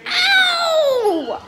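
very high, sharp cry of pain; pained yelp. Acted. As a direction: yelp as if just shoved. Birthday girl pushed the baby.

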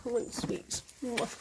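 breathy whisper for the horse. Pretend. Owner voicing her horse begging for sweets in a whisper.

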